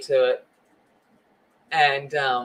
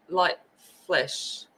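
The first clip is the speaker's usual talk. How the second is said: usual speaking voice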